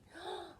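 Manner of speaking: short delighted gasp. Real, not acted. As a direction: gasp in wonder.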